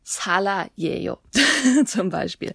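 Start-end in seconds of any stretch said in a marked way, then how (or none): 0.0-1.2 "Sala Yeyo" said very slowly and softly; slow example sentence; slow demo. Shown as a demonstration. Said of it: "einen Namen erfinden".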